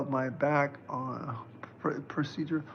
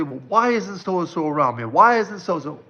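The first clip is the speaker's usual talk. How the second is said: higher, louder mock complaint building up; mock whiny complaint; 5 semitones higher, 6 dB louder than usual. Psychic mimicking clients who complain, mock whine. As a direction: parrot the needy question, getting louder. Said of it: "so many people say".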